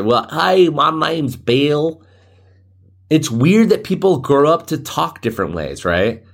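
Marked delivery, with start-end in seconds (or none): 0.0-2.0 higher, drawled American butchering of English; mock American accent. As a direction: introduce yourself in a hammed-up American accent. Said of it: "I mean, we do butcher".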